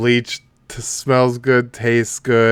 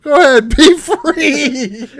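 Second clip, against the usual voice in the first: mock release; 11 semitones higher, 3 dB louder than usual. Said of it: "He's like".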